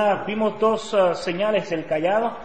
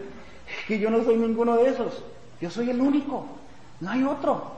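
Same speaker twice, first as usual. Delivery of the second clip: slightly raised pitch, measured firmness; God's answer to Moses; 4 semitones higher than usual. Acted. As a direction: lightly voice God declaring who he is. Voicing God answering Moses.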